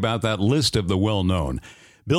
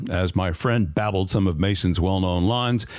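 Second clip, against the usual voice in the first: ordinary talk, nothing marked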